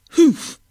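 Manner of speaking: loud offended humph; a loud, offended carp's humph; indignant humph. Acted. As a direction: huff it out like an insulted fish. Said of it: "said Colin".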